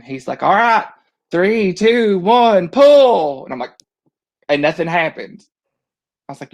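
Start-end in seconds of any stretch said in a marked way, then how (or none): 0.4-3.4 loud, raised countdown call; bullhorn holler. Retold or imitated. Ride worker to the riders hanging up top, hollered countdown. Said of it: "that guy hollers on a bullhorn".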